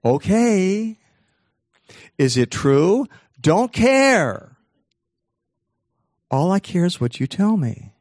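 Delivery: high, loud, carefree cartoon-like answer; breezy yes-man in short, separated bursts; obliging brain. Brain answers him, obedient and indifferent.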